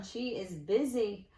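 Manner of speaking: plain reading voice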